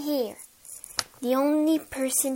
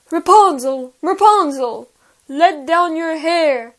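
calling out; 3 semitones higher, 9 dB louder than usual. Acted. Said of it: "he cried".

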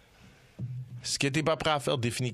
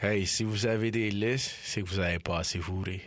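put-on very old man; 6 semitones lower than usual. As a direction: lecture like a grumpy old man. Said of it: "Je vais vous dire avec ma voix de vieux".

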